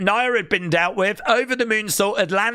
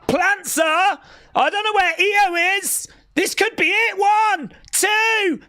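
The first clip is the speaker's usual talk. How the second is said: excited shouting; 11 semitones higher, slower than usual. Real, not acted. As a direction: yell as the title changes hands. Title win called live.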